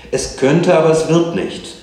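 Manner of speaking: high, loud, emphatic retort; exasperated mother. A narrator's reading. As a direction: shut the argument down loudly. Mother cuts child's argument short.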